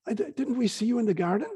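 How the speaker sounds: retold accusing question; questioning accuser. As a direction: challenge as a bystander who recognises someone. Voicing Peter's accuser.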